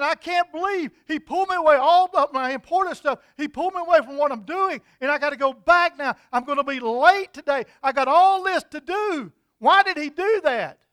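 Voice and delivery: loud, high-pitched indignant griping; angry, resentful complaining. Acted. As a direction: rant about being dragged away from work. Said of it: "and I'm angry".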